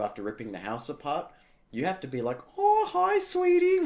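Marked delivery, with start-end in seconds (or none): 2.6-3.8 very high, loud cooing pet-owner voice; sweet greeting. Acted. Owner greeting a dog that wrecked the house, high mock-sweet voice. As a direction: gush over the dog in a put-on high voice.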